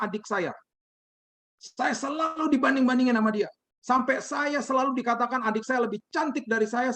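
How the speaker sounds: imitating a high, wailing grievance; pleading high-pitched mock lament; tearful outburst. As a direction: pour out the hurt in a high acted cry. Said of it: "dengan tangisan dia mulai berteriak".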